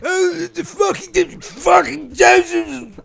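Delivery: high, slow, shouted [name]; high slow [name] yell; [name] impression. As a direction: bellow it out amazed like [name]. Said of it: "play all three parts".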